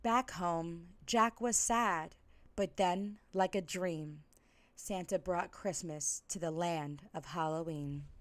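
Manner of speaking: ordinary delivery, nothing marked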